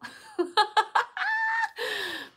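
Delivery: loud high giggle; high laugh. Real, not acted. Big laugh.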